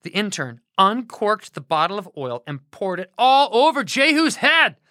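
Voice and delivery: plain reading voice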